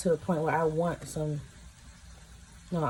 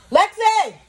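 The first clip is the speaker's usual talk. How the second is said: high, loud shout of a name; calling out; 11 dB louder than usual. Real, not acted. Eater summoning someone mid-taste, calling out loudly. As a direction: yell for someone to come.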